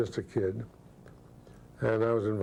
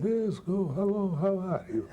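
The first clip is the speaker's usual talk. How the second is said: put-on much higher, small frail old man's voice; Mister Chekhov's voice; 9 semitones higher than usual. Imitating Chekhov greeting the kid at the Russian party, high and frail. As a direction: imitate a tiny frail man greeting a young actor warmly. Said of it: "and he talked like that".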